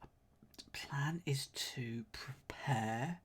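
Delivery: slow whisper to himself. Real, not acted. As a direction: whisper it slowly. Whispering clue reasoning.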